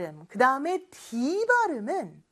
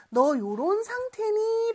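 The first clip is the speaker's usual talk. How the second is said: mock question; 9 semitones higher than usual. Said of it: "라고 상대방에게 질문하는".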